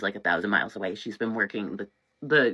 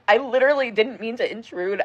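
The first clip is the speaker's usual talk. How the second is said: ordinary talk, nothing marked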